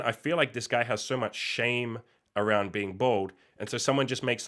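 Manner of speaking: usual speaking voice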